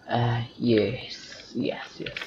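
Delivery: hushed whisper with no voice. Real, not acted. Gamer sneaking past guards, whispering. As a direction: breathe the words so no one notices. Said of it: "And I have to be quiet".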